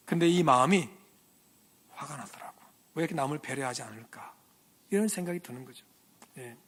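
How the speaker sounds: ordinary delivery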